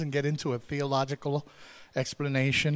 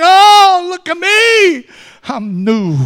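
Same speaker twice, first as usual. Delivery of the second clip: much higher, loud joyful cry; ecstatic praise; 15 dB louder, much slower than usual. Acted. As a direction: shout it like a man just healed. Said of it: "Naaman was praising God and saying".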